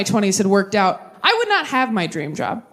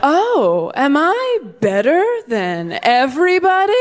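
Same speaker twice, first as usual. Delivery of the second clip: smug; 4 semitones higher, slower than usual. Retold or imitated. Smug inner voice while engaged.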